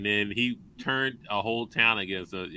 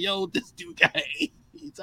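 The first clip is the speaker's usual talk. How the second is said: mock townsperson; slower than usual. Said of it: "It's like".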